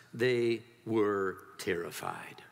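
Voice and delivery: very slow, low, word by word; grave dramatic reading. A narrator's reading. Preacher reading of the disciples' terror, slow and grave. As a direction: space out each word heavily.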